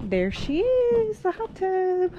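high, slow, delighted reveal; drawn out and high with delight; excited reveal. Real, not acted. Showing off the hot tub.

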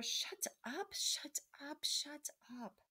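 high, strained whispering; urgent whisper. A narrator's reading. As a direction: hiss it frantically under the breath. Rat desperately silences them.

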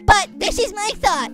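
usual speaking voice

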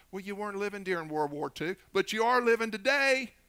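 building to a high, loud challenge; preaching. Real, not acted. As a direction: drive the rebuttal home to the church. Preacher rebuts imagined churchgoer.

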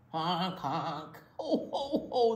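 goose honks melting into a laugh; honk, then laugh it off. A narrator's reading. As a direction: gander laughing at the hedgehog. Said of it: "the gander laughed".

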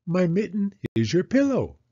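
put-on high, eager child's voice; little girl Penny. Penny asking Mama about snow, high childlike voice. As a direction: ask like an impatient little girl.

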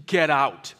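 loud, curt brush-off; angry old resident. Retold or imitated. Angry resident throwing the chaplain out, loud and curt. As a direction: bark it like a grumpy resident shutting the door. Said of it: "the angriest person I'd ever met".

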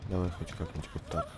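ordinary talk, nothing marked

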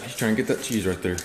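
normal talking voice

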